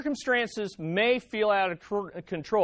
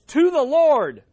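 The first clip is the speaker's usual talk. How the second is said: "to the Lord" punched loud and high; emphatic TO THE LORD; 6 semitones higher, 8 dB louder than usual. Shown as a demonstration. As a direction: proclaim "to the Lord" with full emphasis. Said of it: "I like the emphasis of that".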